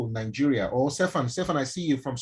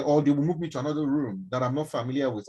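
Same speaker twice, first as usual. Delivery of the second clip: ordinary talk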